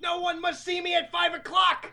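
high laughing put-on shout; melodramatic shout. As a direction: overact it while laughing. Hammed-up dramatic line.

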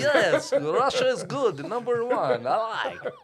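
loud, high, hammed-up Russian accent; put-on foreign accent. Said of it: "I'd be doing my best accent".

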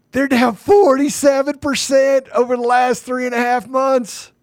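very high, drawn-out proclamation; excited exclamation. Real, not acted. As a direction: stretch the words out in a high, amazed pitch. Exclaiming over a stock crash.

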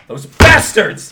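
loud, slow furious cry; angry outburst. Acted. Stoltz feigning outrage to the bar crowd, loud angry cry. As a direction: spit the curse out loud. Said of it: "I'm gonna totally play on this".

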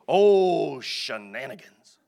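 mock the lazy flesh with a big whiny "Oh". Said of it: "your flesh wants to go".

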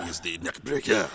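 laughing mock French accent. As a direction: say it like a cartoon Frenchman. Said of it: "we say that in a French accent".

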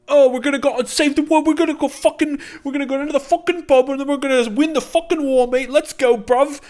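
high, loud mock British lad voice, sweary and cocky; mocking British accent. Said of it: "and they are like".

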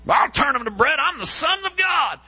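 high, mock-boastful voice of someone eager to prove themselves; proud self-assured reply. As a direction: toss it off like a show-off. Mocking our own proud reply.

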